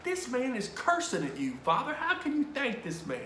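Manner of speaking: high, strained incredulous plea; stunned disbelief. Retold or imitated. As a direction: question him in mock bewilderment. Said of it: "I'm just dead".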